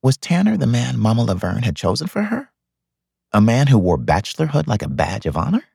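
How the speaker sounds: plain reading voice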